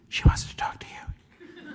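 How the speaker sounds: hushed aside with a laugh; whispering. Acted. Aside to fiance off-mic.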